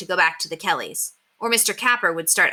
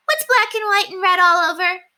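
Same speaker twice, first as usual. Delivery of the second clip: put-on much higher, louder girlish riddle; little girl voice; 13 semitones higher, 5 dB louder than usual. A narrator's reading. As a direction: ask it eagerly, like a pest. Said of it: "Ramona always asked next".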